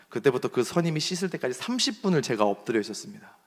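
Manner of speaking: ordinary talk, nothing marked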